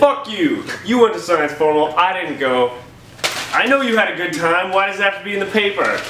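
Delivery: loud, high, hammed-up outburst; mock rant. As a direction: yell it like a jealous student left out.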